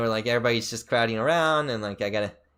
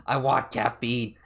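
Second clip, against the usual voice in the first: craving brain voice; much slower than usual. Retold or imitated. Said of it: "my brain just went like".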